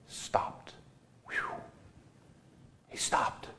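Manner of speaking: a soft whispered pause; hushed whisper. Real, not acted. Preacher hushes the congregation as Jesus stops, whispered. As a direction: let it land in a whisper. Dramatic hush at climax.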